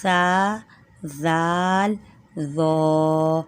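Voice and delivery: سا زال ذو with tongue between teeth, softly; gentle letter demo. Shown as a demonstration. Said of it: "ذو کو موٹا بھی کرنا ہے".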